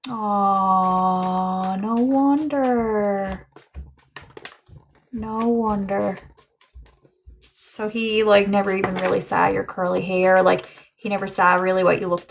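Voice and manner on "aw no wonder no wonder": tender realization. Real, not acted. Touched realization.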